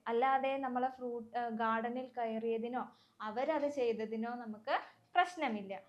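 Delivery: ordinary delivery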